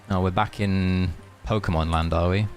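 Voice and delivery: ordinary talk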